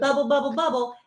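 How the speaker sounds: high-pitched mimicked chitchat; perky, high burbling; bubbly chatter. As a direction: babble brightly as filler small talk. Imitates own bubbly chatter.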